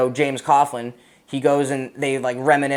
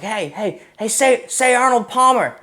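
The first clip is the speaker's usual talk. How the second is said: teasing Marine; 9 semitones higher, 4 dB louder, slower than usual. Retold or imitated. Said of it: "someone was like".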